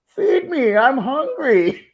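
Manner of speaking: put-on squeaky high baby voice, drawn out. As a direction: whine it like a hungry baby. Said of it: "makes the butt cheeks talk with, like, a baby voice".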